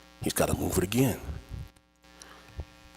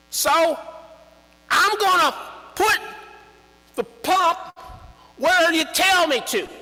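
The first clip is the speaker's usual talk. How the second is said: high, loud put-on Charlie; eager to please, way up high; 5 dB louder than usual. Charlie promising to dig wherever he's told. Charlie voiced deferring to father.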